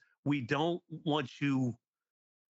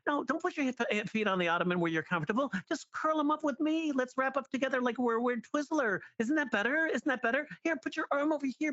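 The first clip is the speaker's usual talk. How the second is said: put-on much higher, sing-song nagging girlfriend; high cloying girlfriend imitation; clingy girlfriend voice; 12 semitones higher than usual. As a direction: coax him like a smothering partner. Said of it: "he keeps going on with this, this voice".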